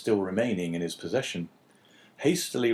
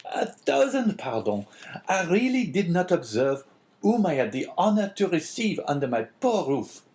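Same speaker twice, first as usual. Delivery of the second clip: high-pitched apologetic exclaiming; Caderousse; 7 semitones higher than usual. A narrator's reading. Innkeeper apologising to a priest, exclaiming. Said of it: "Caderousse hastily exclaimed".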